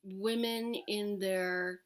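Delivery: ordinary talk, nothing marked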